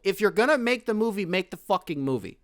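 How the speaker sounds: high, heated demand in one run; worked-up, high-pitched insistence; exasperated rant. Real, not acted. Heated rant, repeats catchphrase.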